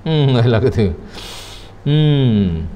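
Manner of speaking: usual speaking voice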